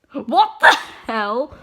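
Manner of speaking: loud, slow, stunned what the hell; loud shocked cry; shocked outburst. Real, not acted. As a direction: blurt it out in disbelief. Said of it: "reader reacts to the story".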